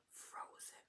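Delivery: breathy whispered word, frozen in shock. Acted. Counselor acting out people frozen by bad news, whispering. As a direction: whisper it like someone frozen stiff.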